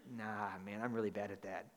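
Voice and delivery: playing a shrugging listener, soft; mock sheepish reply. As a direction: mumble it like a shy churchgoer. Congregation member's imagined reply.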